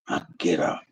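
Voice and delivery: whispered, grudging. Retold or imitated. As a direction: breathe it out under your breath. Reluctantly getting up, retold.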